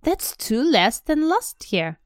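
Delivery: Dudley counting his presents, whiny and high; spoiled child. Acted. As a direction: count like a sulking spoiled boy. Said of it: "he said".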